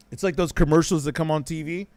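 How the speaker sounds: usual speaking voice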